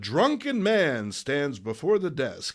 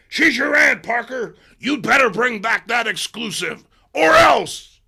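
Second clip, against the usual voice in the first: loud, high barked ultimatum; threatening shout; 9 semitones higher, 11 dB louder than usual. Acted. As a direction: bark it as a threat. Jameson threatening.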